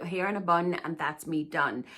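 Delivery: ordinary talk, nothing marked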